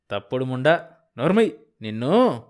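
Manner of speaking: angry snarl. Acted. Husband lunges at wife.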